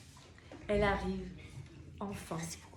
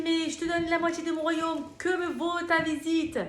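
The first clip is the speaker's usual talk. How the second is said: higher, louder royal question; high king voice; 8 semitones higher, 5 dB louder than usual. Acted. King asking Esther why she came, high and loud. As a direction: play the eager king.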